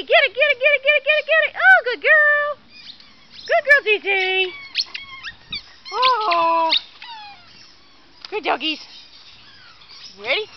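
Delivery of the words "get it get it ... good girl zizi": rising, higher and louder cheering on the dog; excited urging a dog. Real, not acted. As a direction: egg the dog on, climbing in pitch.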